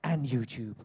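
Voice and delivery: a hint of a show announcer; introduction voice. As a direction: present him like a compère, lightly. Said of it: "I'll do this in an introduction voice".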